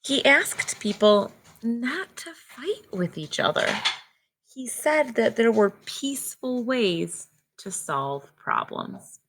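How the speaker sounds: clear, measured read-aloud storybook voice; reading to children. Acted. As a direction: read the book to the class slowly. Teacher reads picture book aloud.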